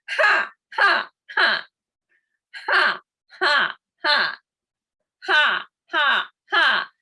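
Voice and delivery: forceful, high grumpy "ha" bursts; pretend grumpy. Shown as a demonstration. Instructor starting the air pump grumpy, mock-grumpy bursts. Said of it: "Let's start with grumpy".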